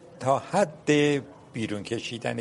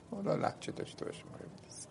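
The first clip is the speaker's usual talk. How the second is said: whispered softly, almost voiceless; whispered aside; 5 semitones higher, 13 dB quieter, faster than usual. Real, not acted. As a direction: drop to a confiding whisper as an aside. Aparté chuchoté sur l'accent.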